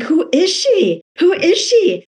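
high-pitched, drawn-out astonishment; amazed retold reaction. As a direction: exclaim it high and slow, wowed by a stranger.

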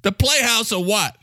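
high, cocky New York character; Mister Coffee. Acted. New Yorker boasting and inviting International by, high and brash.

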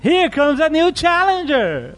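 slow, high booming announcer call; fighting game announcer. Retold or imitated. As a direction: announce the new challenger. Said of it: "bota a ficha pra jogar contra você".

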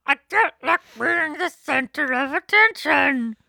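loud, high mock wail; drawn-out, high-pitched motherly complaint; aggrieved mother. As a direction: play a scandalized mother shouting. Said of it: "get my mother not to talk to me".

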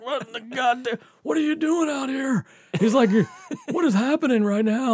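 high, half-laughing imitation of a rudely woken dad; startled dad.

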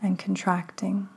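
hushed, unhurried breathing cues; guided meditation. Acted. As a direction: ease the listener into calm, breath by breath.

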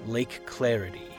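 plain reading voice

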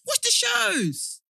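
high, loud, drawn-out command; slow high-pitched playful scolding; exasperated order. Real, not acted. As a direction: order your friend, stretching every word. Scolding co-host in playful quarrel.

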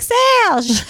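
high, imitated French self-introduction; French accent. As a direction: announce the name like a hammed-up Frenchman. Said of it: "the guy with the accent".

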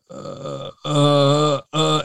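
high, tongue-tied mock hesitation; starstruck stammer. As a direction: freeze up like a starstruck kid on the phone. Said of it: "And I went".

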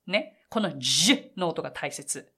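normal talking voice

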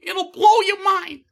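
hammed-up slow falsetto; silly falsetto. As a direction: sell it like a giddy cartoon salesman. Mimicking character falsetto voices.

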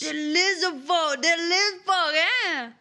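high, loud character voice; insistent Boudreaux. Acted. Player as Boudreaux explains family to Willow, insistent.

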